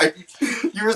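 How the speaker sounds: high, surprised mock question; incredulous. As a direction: imitate her disbelief. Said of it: "You're like".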